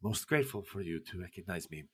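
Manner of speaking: put-on a gracious gentleman with a soft foreign accent, caught off guard; Pessoa's accent. Poet greeting the admiring women, soft foreign-accented courtesy. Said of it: "shocked to hear another accent that isn't Russian".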